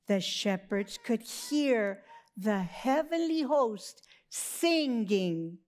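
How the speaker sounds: usual speaking voice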